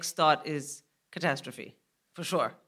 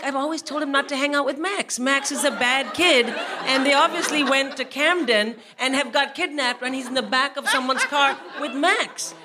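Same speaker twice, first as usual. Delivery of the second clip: high, loud worked-up rant; hammed-up panicking mother; acted frantic outrage; 6 semitones higher, 4 dB louder than usual. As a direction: rant higher and louder in mounting exasperation. Retelling her panicked angry thoughts.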